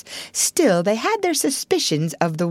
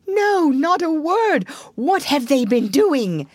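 high, drawn-out alarm; frightened cry; 9 semitones higher, 3 dB louder than usual. A narrator's reading. Toad trembling as he asks Rat, frightened. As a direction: wail it anxiously, pitched up. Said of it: "trembling in every limb".

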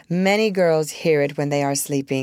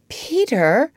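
high, hesitant call of his name; a touch of faltering doubt; 8 semitones higher, 3 dB louder than usual. A narrator's reading. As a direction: address him uncertainly, pitch raised. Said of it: "she said, faltering".

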